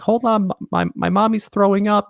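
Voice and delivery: put-on high, young child's voice on the phone; little kid voice. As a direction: pitch way up like a small kid. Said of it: "some high powered hedge fund guy that we're like".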